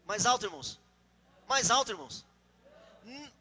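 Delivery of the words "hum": ordinary talk, nothing marked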